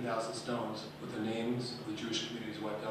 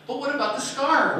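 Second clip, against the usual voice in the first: put-on high, loud kids cutting in; impatient kids; 11 semitones higher, 10 dB louder than usual. Pupils interrupting the teacher's story, high and loud.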